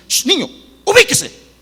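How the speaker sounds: high, loud hushing rebuke; father scolding. Retold or imitated. Father silencing his son's big dreams, loud and high. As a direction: cut the dreaming child off hard. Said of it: "está oyendo el padre y dice".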